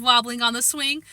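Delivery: ordinary delivery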